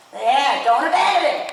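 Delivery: loud, firm call across the arena; brisk projected riding commands. Real, not acted. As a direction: project it to the rider on the horse. Coach calling across arena.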